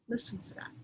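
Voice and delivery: hushed reminder to listen; secret-sharing teacher whisper; whispered cue. Real, not acted. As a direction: whisper it to the class. Teacher cues class, whispered.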